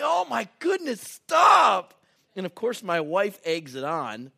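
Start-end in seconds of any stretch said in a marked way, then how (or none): 0.0-1.9 high yelp rising to a loud "stop"; hammed-up shriek of protest; mock panicked plea. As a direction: beg them to stop in comic panic. Flees his kids' breath.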